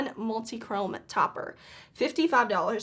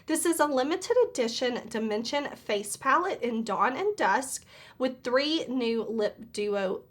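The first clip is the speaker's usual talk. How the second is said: usual speaking voice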